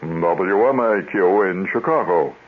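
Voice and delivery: drawn-out, official station ID; very official announcer. Retold or imitated. Host imitates the old station announcer, very official. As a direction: stretch the call letters like an official announcer. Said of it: "the announcer sounded very official".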